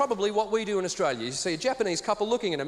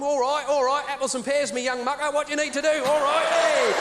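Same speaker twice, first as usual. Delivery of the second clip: London cockney; 5 semitones higher, 3 dB louder than usual. Pretend. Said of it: "the London equivalent".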